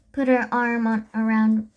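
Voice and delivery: ordinary delivery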